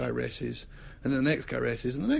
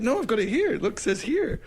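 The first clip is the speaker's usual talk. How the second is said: insistent, laughing; 10 semitones higher than usual. Retold or imitated. Host insisting to the racer it says charity, high and laughing. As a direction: push the paper at him, laughing. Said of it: "I said".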